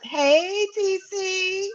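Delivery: high bright hello; excited greeting. Real, not acted. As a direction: greet the caller with delight.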